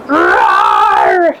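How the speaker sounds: trapped lion giving a deep, loud roar. Acted. Trapped lion roars again.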